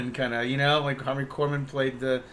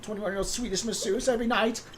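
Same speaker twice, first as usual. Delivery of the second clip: higher whiny plea, rising at the end; mock pleading Kathy; 8 semitones higher, 3 dB louder than usual. Playing Kathy begging her co-host, high whiny mock plea. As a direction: beg for sympathy, hammed up.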